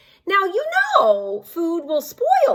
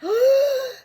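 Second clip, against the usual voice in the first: high sharp pre-sneeze gasp; 12 semitones higher than usual. Acted. Reading to kids, wolf's gasp before sneezing.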